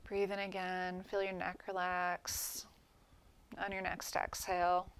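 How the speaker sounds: calm meditation guide, long pauses between phrases; guided meditation. Real, not acted. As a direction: gently lead them through relaxing each body part, letting phrases settle into silence. Leads guided breathing relaxation meditation.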